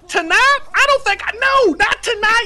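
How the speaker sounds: high, loud, slow, near-crying plea; begging the trainer off, high, slow, near tears; panicked whine. Retold or imitated. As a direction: wail it like you're begging off.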